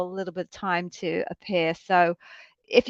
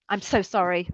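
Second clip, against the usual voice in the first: usual speaking voice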